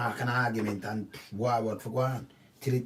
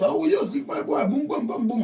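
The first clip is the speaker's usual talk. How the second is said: higher, excited mock hothead with boom boom sound effects; 10 semitones higher, 3 dB louder than usual. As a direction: ham up a guy jumping out of his car ready to fight. Acted hothead jumping out.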